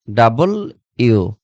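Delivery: ডাবল then ইউ, each part said separately; correct pronunciation repeated. Shown as a demonstration. Teacher repeats for students, ডাবল ইউ as two words. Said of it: "কি হবে?".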